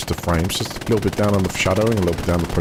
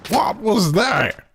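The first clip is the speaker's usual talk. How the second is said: man down shout; 3 dB louder, slower than usual. Real, not acted. Hit in the head in game.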